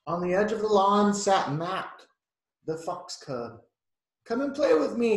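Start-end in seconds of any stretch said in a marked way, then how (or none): 4.3-5.2 higher, louder eager fox cub; fox cub calling. Acted. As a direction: invite Penny with eager barking energy. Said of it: "he barked".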